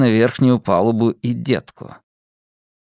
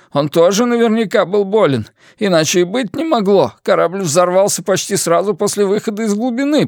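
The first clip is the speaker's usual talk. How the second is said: a hint of a breathless, higher, panicky man; lightly voiced nervous man; 8 semitones higher than usual. A narrator's reading. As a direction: blurt it out, shaken and rushed. Fim talking after the stun.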